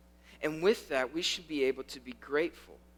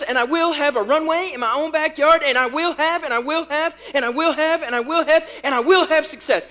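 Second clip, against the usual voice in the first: loud, high chant building in force; mock bold declarations; 9 semitones higher, 12 dB louder than usual. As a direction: hammer out each over-confident claim, louder and higher. Acted name-it-claim-it declarations, building.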